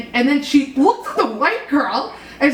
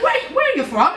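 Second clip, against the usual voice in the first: high-pitched put-on British accent; mock British accent; 8 semitones higher than usual. Woman meeting her phone contact, mock British accent. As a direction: imitate a puzzled woman with British vowels. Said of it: "I'm just gonna do a British one".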